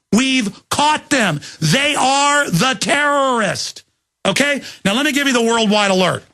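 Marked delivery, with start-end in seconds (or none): 0.1-3.8 higher, very slow accusing lines; slow accusation. Real, not acted. Host accusing the government on air, slow accusing emphasis. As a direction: spell out the accusation word by word.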